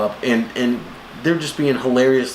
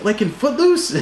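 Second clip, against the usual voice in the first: much higher, eager question; mock Star-Lord; 11 semitones higher than usual. Star-Lord excited about Footloose, high mock. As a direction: ask it like a thrilled fan. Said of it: "He's like".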